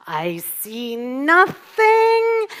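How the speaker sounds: slow German sergeant, rising high; German accent. Acted. Imitating a sitcom sergeant who overlooks much, German accent. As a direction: stretch it out loud in a comic German accent. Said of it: "so that's my German accent".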